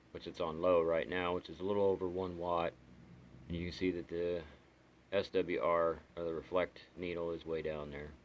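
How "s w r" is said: ordinary talk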